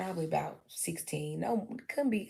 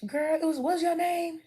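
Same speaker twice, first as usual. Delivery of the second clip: put-on higher, louder chatty girl-talk; bubbly friendly greeting; 10 semitones higher, 5 dB louder than usual. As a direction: greet a new friend with delight. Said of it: "And I'd be like".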